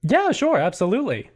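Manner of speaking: lightly voiced obliging friend on the phone; Stolas. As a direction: agree at once, voice lifted. Game master plays Stolas on phone.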